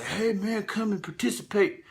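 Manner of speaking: lightly voiced cheerful crowd, luring him in; mock friendly invitation. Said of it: "And everybody's like".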